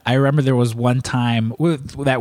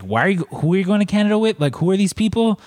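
anxious mom; 7 semitones higher than usual. Retold or imitated. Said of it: "She's like".

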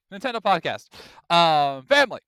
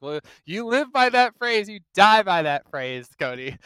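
mocking taunt; 6 semitones higher, 6 dB louder than usual. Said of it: "I'm like".

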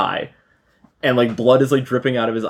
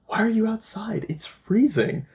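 higher, softer mock-caring mother; sudden fake-sweet mom; 9 semitones higher, 5 dB quieter than usual. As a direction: play the mom switching to sugary concern. Abusive mom suddenly acting caring.

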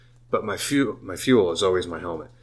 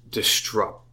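"disrupt" blurred by drink. Real, not acted. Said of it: "my drunken voice right there".

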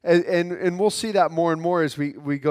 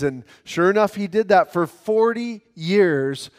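usual speaking voice

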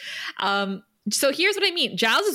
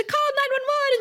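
very high alarmed cry; frantic mom. Retold or imitated. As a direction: shriek for help. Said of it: "the mom, like".

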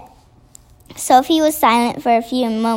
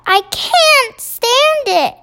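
crying out; 6 semitones higher than usual. A narrator's reading. Sophie pleads with the BFG to act, crying out. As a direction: cry out in protest, pitched well up. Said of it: "stood up and cried out".

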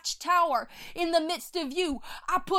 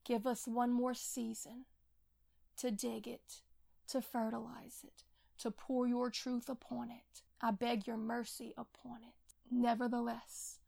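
softer, pleading intercession; soft prayer; 3 semitones lower, 9 dB quieter than usual. Real, not acted. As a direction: beg the Lord for mercy, hushed. Quiet closing prayer.

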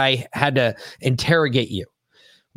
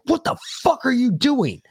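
about to lose it; 10 semitones higher than usual. Retold or imitated. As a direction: retell losing your cool, voice shooting up. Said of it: "I was about to lose my shit".

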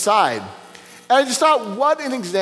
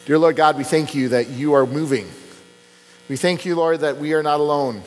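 lower, earnest prayer voice; closing prayer; 5 semitones lower than usual. Acted. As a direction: pastor praying to God to close the sermon. Pastor leads closing prayer.

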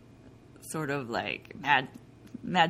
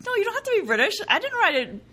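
laughing protest; 13 semitones higher, 4 dB louder, faster than usual. Retold or imitated. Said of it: "And I'm like".

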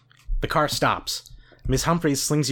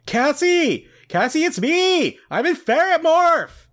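high, loud, drawn-out shouts; desperate calling out; 6 dB louder, slower than usual. Acted. Trapped ferret calling to Cassie for help, shouted. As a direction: call out urgently at a high pitch.

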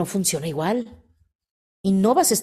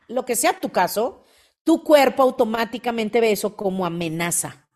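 ordinary talk

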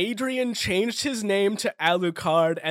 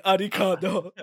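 put-on Japanese accent; 2 semitones higher, 4 dB louder than usual. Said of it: "but with a Japanese accent".

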